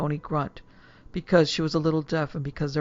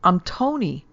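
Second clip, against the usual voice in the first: scream; 5 semitones higher, 3 dB louder, slower than usual. Acted. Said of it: "he screamed wordlessly".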